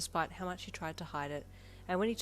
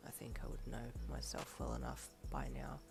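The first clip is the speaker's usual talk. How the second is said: dark tone; 5 semitones lower, 8 dB quieter than usual. A narrator's reading. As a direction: let it go cold and dark. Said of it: "he replied in a dark tone".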